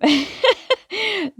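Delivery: high-pitched giggle. Real, not acted. Reader giggling after hiccups interrupt her reading, high giggle. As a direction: burst into a short, high giggle.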